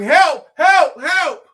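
high, loud cries for help; frogs yelling for help. Acted. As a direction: yell it up from the bottom of a hole. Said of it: "They start yelling".

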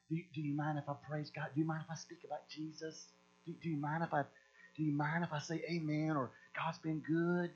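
mock timid asking. Weak Christians timidly asking permission to praise God, mock meek hush. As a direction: shrink down like a nervous pansy asking leave. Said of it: "we're like a bunch of little pansies".

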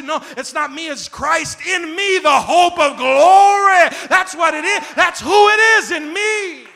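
roaring, slowed sermon shout; preaching at full force. Real, not acted. Pastor roaring to the congregation, full-force preaching. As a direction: hammer the hope of glory home.

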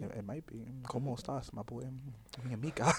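low, very soft murmur; mock ASMR. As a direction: play along in a hushed ASMR voice. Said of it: "A S M R part three".

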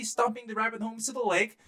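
ordinary delivery

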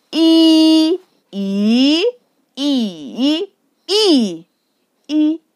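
"一" level, rising, dipping, falling, short; Mandarin tone drill. Shown as a demonstration. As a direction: run "一" through all five tones in order. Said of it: "I put different tones on them".

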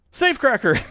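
a loud, high, hammed-up Irish accent; bad Irish accent. Podcast host imitating the Irish forum member's quiz answer in a bad Irish accent. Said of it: "a very terrible Irish accent".

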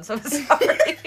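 apologizing through laughter; laughing. Real, not acted. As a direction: giggle out the apology.

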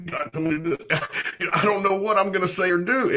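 laughing, high, fast; high laughing retelling. Retold or imitated. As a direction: laugh through the awkward memory. Laughing retelling of awkward moment.

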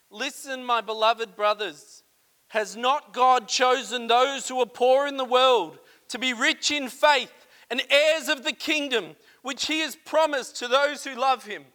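loud, proclaiming scripture reading; preached scripture. Acted. Preacher reading the verse to his congregation, loud. Reads James chapter two aloud.

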